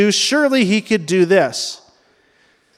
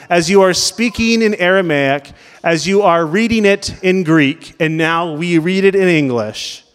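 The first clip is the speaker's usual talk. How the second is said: ordinary delivery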